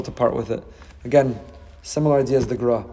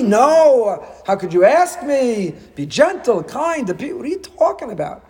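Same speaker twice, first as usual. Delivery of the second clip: indignant refusal; 9 semitones higher than usual. Retold or imitated. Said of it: "Someone would say".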